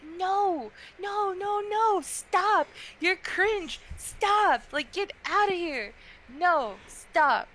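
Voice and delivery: a played-up fed-up yell of stop; mock exasperated shouting. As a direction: shoo away a cringey fan in mock outrage. Mocking astrology-obsessed people.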